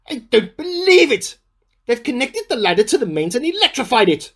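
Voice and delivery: high, loud outraged disbelief; shocked disbelief. Acted. Diarist finds the loft ladder electrified, shocked outburst. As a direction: sputter it out, stunned and indignant.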